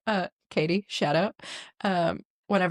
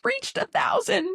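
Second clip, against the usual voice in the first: mock proud announcement; slower than usual. Celebrating view count.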